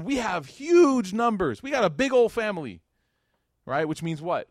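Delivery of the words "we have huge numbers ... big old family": boasting tribe. Retold or imitated. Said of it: "And they said".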